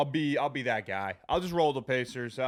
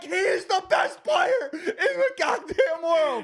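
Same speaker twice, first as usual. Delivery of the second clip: high, slow, ecstatic cracking shout; ballistic shout; 7 dB louder, slower than usual. Retold or imitated. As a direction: scream with joy as the buzzer beater drops. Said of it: "I went ballistic".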